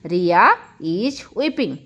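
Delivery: very slow, stretched repeat. Shown as a demonstration. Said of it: "repeated model sentence". (बहुत धीमी दोहराई)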